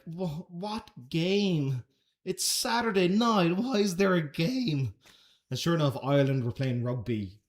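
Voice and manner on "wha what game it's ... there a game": drawn-out, high-pitched disbelief; slow, rising-in-pitch incredulous protest at the very idea. Retold or imitated. Acting his reply to cousin.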